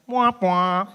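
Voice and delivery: high "wah-wah" losing sound; comic game-over noise; mock failure noise. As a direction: imitate a sad trombone. Imitates failure noise as doctor's answer.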